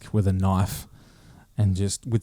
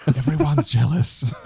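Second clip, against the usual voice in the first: slower, laughing through the words; 5 semitones higher than usual. Real, not acted. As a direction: crack up while repeating it. Repeats joke, second laughing.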